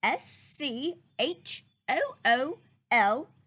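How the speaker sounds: slow letter-by-letter spelling; spelled out. Acted. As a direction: spell each letter out slowly. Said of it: "said he was going to".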